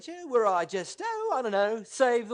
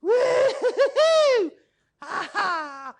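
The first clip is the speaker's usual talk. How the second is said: high whooping laugh; 8 semitones higher, 4 dB louder, much slower than usual. Acted. Triumphant whoop.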